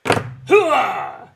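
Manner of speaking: loud, high hooah shout; loud gung-ho soldier. Retold or imitated. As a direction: bark it like the blind colonel. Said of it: "Colonel what? Frank something?".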